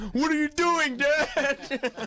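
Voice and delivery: a son yelping at his dad's outburst, in a mock high whine; startled grown son. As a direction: squeal it like a shocked grown kid catching his dad. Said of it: "forty-two-year-old Walt Junior is going".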